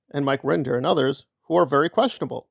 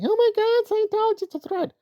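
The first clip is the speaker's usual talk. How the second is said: much higher, airy mock panic; mocking Liz. Mocking Liz's fake alarm at the protest, in a shrill-high squeal. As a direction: lampoon her fake alarm, pitched way up. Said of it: "and saying".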